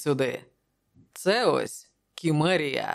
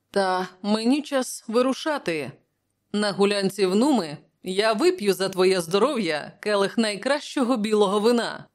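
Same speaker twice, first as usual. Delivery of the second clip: lifted, higher friendly voice; Prospero, genial companion; 5 semitones higher than usual. Acted. Prospero takes leave of the king, in good cheer. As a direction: announce a cheerful departure in a higher register.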